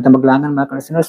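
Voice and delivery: usual speaking voice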